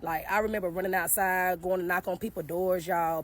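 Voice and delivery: normal talking voice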